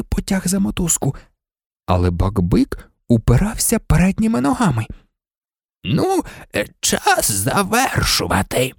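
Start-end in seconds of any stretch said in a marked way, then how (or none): 5.8-8.7 put-on slow old official's croak; creaky, groaning. A narrator's reading. Committee member wanting to finish up, creaky and groaning. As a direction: drag it out with an effortful croak. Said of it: "прокректав голос члена комітету".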